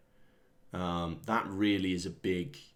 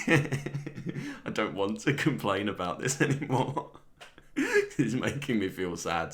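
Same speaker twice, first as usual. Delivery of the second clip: higher voice broken up by chuckles; laughing mock complaint; 3 semitones higher, 4 dB louder than usual. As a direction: act fed up while giggling through it. Acting complainers while laughing at himself.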